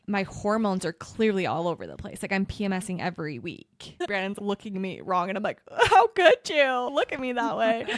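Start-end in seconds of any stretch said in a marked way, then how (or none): 5.9-7.9 high, loud wounded whine; mock overdramatic hurt. Wife acting hurt at husband's glance, overdramatic. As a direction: ham up the offended wife.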